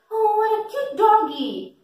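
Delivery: put-on high, sing-song baby talk to a puppy; talking to a dog. Shown as a demonstration. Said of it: "when I'm talking to a dog".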